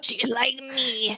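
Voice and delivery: slow, sly, sinister murmur; evil grandma hint. Shown as a demonstration. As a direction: savour it with a wicked hint. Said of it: "little evil look on your face when you said".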